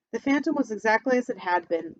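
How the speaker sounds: plain reading voice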